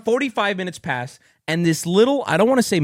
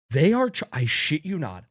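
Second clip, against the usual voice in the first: normal talking voice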